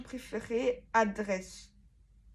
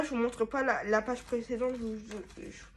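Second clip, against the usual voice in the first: ordinary talk, nothing marked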